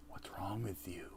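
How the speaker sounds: voiceless whisper; whispered movie quote. Acted. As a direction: hiss it out like the movie. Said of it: "You know what movie that's from".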